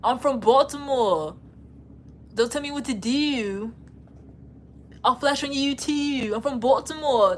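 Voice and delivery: mock Baltimore accent with drawn-out words. Streamer making fun of how they talk, imitated Baltimore accent. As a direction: exaggerate a Baltimore accent, dragging the vowels. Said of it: "That's how they talk".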